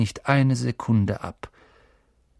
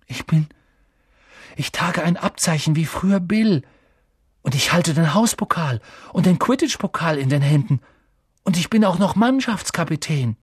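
put-on high, proud, excited boy; breathless boyish triumph, pitched high; thrilled amazement; 7 semitones higher, 4 dB louder than usual. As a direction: announce each marvel with mounting delight. Boy thrilled by his mirror image.

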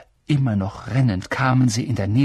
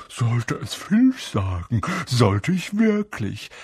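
Peeves, falsely gentle; slower than usual. A narrator's reading. Peeves threatening to tell Filch, falsely gentle. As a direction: draw it out with mock sweetness. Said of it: "sagte Peeves mit sanfter Stimme".

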